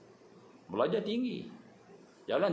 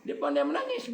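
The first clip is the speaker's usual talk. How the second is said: normal talking voice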